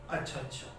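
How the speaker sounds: अच्छा, अच्छा said low and soft with a falling tune; quiet settled fall on अच्छा, अच्छा; accepting okay. Shown as a demonstration. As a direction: nod it off, a calm agreeing अच्छा अच्छा. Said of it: "अच्छा शब्द को मैंने किस तरीके से बोला".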